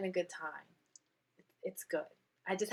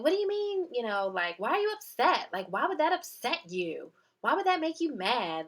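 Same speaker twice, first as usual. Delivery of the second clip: put-on defensive boyfriend; 5 semitones higher, 4 dB louder than usual. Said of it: "he got really defensive".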